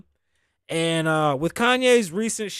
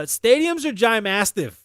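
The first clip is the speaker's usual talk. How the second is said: ordinary talk, nothing marked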